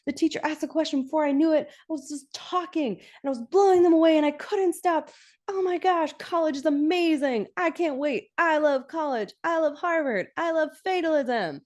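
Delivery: high-pitched giddy gushing; imitating an overexcited student; excited college girl. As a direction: gush breathlessly in a high, thrilled voice. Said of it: "she's so excited".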